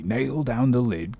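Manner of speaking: "nail down the lid" said softly, half under the breath; muttered to himself. A narrator's reading. Carpenter going over his orders alone, softly muttering. Carpenter recites task list.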